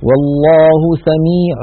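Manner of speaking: سميع ending in a long nasal m, slowly; iqlab with ghunna. Shown as a demonstration. Teacher models the tanwin turned to m. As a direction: hold the m hum after سميع. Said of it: "ثم نمط غنته".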